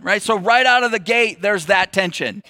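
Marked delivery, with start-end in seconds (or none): none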